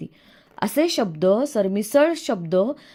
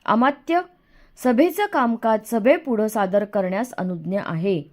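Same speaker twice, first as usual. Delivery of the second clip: usual speaking voice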